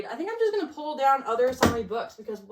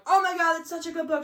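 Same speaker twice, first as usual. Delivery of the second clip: higher and louder, gushing about the book; excited gushing; 7 semitones higher, 5 dB louder than usual. Retold or imitated. Friend Libby raving about the book, excited gushing. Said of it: "She was like".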